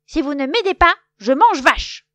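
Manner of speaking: very loud threatening shout. A narrator's reading. Loup holding Vache hostage, loud threat. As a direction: threaten at full volume. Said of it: "il crie".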